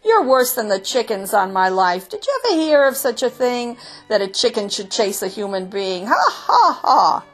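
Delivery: hammed-up derisive laughter; bursting into laughter. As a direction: jeer between high, hearty ha-has. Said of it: "the men burst into laughter".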